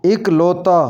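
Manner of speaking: इकलौता opening on 'ik'; correct pronunciation. Shown as a demonstration. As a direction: say इकलौता as 'ik-lau-ta'. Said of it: "इसका सही तलफ़ुज़ होगा". (इकलौता को 'इ' से शुरू करें)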